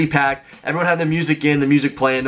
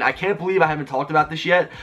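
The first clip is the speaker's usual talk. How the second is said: normal talking voice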